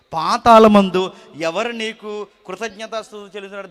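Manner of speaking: proclaimed loudly. Shown as a demonstration. Preacher re-reading David's verse to the congregation, loud and slow. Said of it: "ఏమంటున్నాడు చూడండి".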